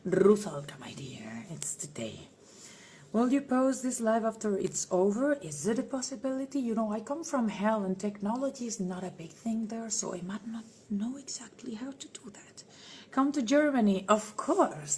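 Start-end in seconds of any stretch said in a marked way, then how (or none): 0.8-2.3 low whisper. Acted. Whispering to a fan. 9.9-12.6 whisper. Acted. Joking she comes from hell.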